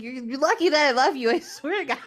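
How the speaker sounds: high-pitched exasperated warning; grudging affection, played annoyed; mock fed-up; irritated. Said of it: "She's so irritated".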